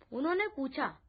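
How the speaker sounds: usual speaking voice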